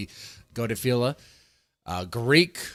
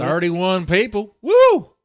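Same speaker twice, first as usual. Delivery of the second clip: excited cheer; 7 semitones higher, 10 dB louder, slower than usual. Real, not acted. Cheering his viewer count.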